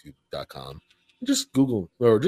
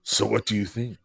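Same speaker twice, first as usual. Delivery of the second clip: normal talking voice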